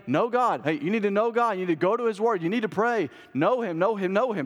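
high-pitched urgent pleading, phrase after phrase; exhorting. Acted. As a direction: urge them on like an Old Testament author. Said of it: "the authors were coming exhorting".

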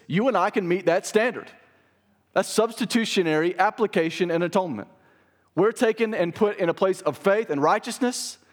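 loud, high fervent preaching; preaching at full force. Acted.

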